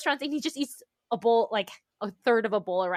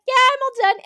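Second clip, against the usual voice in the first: put-on much higher, louder carefree child; breezy kid; 13 semitones higher, 11 dB louder, faster than usual. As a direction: toss it off like a toddler who's finished.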